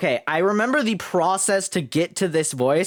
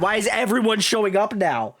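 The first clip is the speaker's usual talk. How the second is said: ordinary talk, nothing marked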